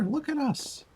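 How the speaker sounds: ordinary talk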